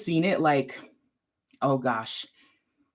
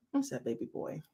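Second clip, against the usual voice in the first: soft cooing; 3 semitones lower, 13 dB quieter than usual. Real, not acted. Cooing at the dog.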